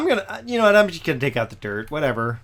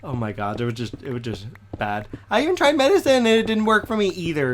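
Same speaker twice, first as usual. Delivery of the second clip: usual speaking voice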